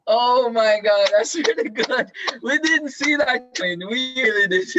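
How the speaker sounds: high, loud delighted laughter through the words; laughing out loud. Real, not acted. Teacher laughing at a student's funny answer, loud and delighted. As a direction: crack up laughing while praising.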